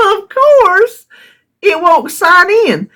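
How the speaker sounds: laughing. Real, not acted. Laughing while complaining.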